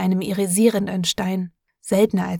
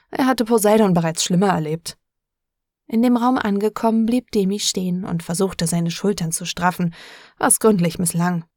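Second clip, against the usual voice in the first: ordinary delivery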